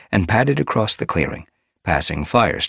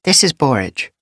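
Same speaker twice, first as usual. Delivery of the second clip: higher, lightly voiced she-cat; calm healer, a touch higher; wise medicine cat; 10 semitones higher, 5 dB louder than usual. A narrator's reading.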